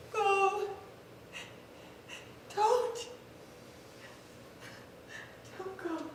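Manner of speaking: lover's last plea as he goes; fading plea. Acted. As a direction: let the voice fade to nothing.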